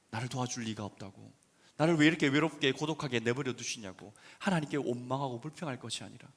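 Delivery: normal talking voice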